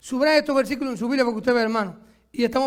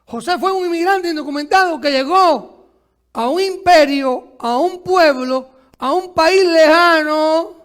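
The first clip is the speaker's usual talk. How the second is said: rising, louder preacher's cadence; preaching; 4 semitones higher, 5 dB louder, slower than usual. Acted. Pastor stirring congregation about José, building preaching. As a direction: pound out each phrase to the church.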